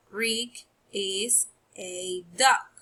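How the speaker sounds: slow, word-by-word "Rick is a duck"; slow reading aloud. Shown as a demonstration. Teacher reading the sentence slowly to pupils. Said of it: "let's read the sentence".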